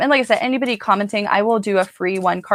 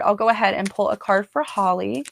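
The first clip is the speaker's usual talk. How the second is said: ordinary talk, nothing marked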